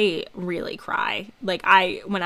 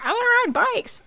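high pleading I wanna ride bikes; whiny childlike plea. Retold or imitated. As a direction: beg like a kid for a treat. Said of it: "and I was like".

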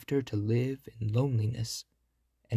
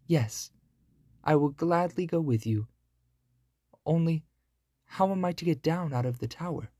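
put-on young girl voice; 5 semitones higher than usual. Rapunzel's voice.

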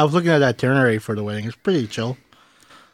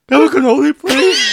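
high, loud yelp of a cracking voice; imitating Marcel; 8 dB louder than usual. Friend imitating Marcel ordering a cannoli, voice cracking high and loud. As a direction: squeak it out like a voice breaking. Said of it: "Marcel's like".

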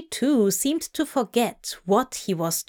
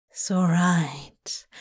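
tender whisper; 3 semitones lower, slower than usual. Acted. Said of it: "Crowley whispered tenderly".

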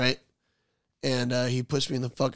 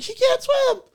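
very high, loud mock-mom cry; panicked mom; 5 dB louder, slower than usual. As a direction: cry out in alarm, imitating mom. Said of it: "my mom was like".

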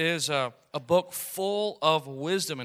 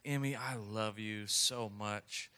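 tender coaxing; 5 semitones lower, 9 dB quieter, slower than usual. Retold or imitated. Father coaxing toddler.

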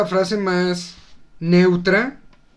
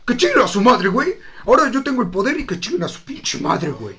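defiant mock rant; 5 semitones higher than usual. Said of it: "Dijiste, así".

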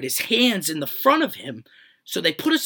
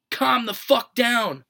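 slow, higher, spaced out, furious; angry command; 7 semitones higher, 4 dB louder, much slower than usual. Retold or imitated. To the panicking friend in the police car, a slow furious order. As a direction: drag each word out, fed up.

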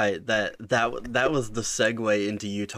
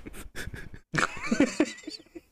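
high laugh. Real, not acted. Laughs after the winks.

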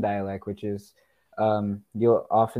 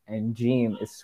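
ordinary talk, nothing marked

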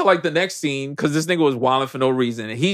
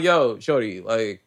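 low, softer dismissive bro voice; typical bro; 6 semitones lower, 4 dB quieter than usual. Retold or imitated. Wolf pack bro sends the girl away, low and dismissive. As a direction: wave her off like a cool bro, voice dropped. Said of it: "in typical bro fashion".

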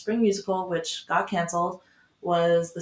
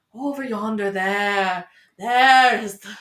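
put-on slow old northern English woman's voice, getting louder at the end; Mrs Medlock old woman voice; 7 dB louder, slower than usual. Playing the housekeeper in The Secret Garden, slow old-woman northern voice. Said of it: "like, old woman voice".

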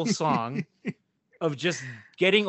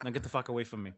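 low muttered retort, softer and lower; muttered retold threat; 5 semitones lower, 9 dB quieter, faster than usual. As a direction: mutter it under your breath, like an aside. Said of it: "everything usually followed with".

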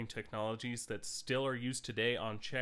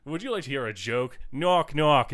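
quoting the movie; 4 semitones higher, 9 dB louder than usual. Retold or imitated. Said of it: "They just do, like".

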